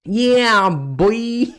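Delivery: high, loud hype shout; [name] yell. Pretend.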